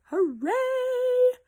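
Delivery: high bright-pitched hooray; excited cheer. Real, not acted. Host cheering Meg for making it, a high hooray. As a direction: celebrate that she made it.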